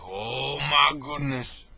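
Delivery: long drawn-out catchphrase exclamation; Booker T. Acted. Reviewer quoting an amazed wrestling commentator, drawn-out exclamation. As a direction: stretch the first word right out, swelling in amazement. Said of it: "to quote Booker T".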